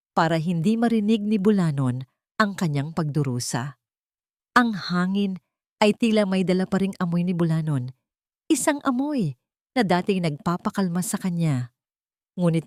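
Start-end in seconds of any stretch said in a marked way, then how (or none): none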